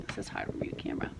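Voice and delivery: fast, soft whisper to the camera; a quick under-the-breath aside; hushed rushed whisper while fumbling the camera. Real, not acted. Aside while filming the book.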